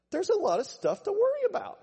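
high-pitched, loud fretting; mock-panicked worrier's voice; anxious protest. Voicing those who struggle with anxiety.

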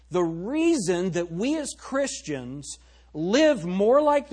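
slow, swelling pulpit preaching. Acted. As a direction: drive the exhortation home from the pulpit. Preaching full force on walking.

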